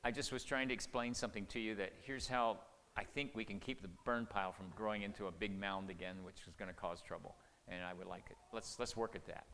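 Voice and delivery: soft, patient and reassuring; calm her down gently; a hushed, kind explanation to a worried wife; gentle explanation. Shown as a demonstration. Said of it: "you go back and you say".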